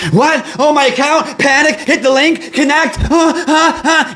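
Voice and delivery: very high, frantic hammed-up panic; mock panic. Streamer acts out a panicked user clicking the link, mock frantic. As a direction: play the flustered victim clicking.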